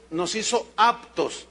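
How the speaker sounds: "nos hizo aptos" said slowly, leaning on "hizo"; stressed key phrase. Shown as a demonstration. Pastor stressing the key phrase to the congregation, slow and weighted. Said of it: "la palabra clave aquí es".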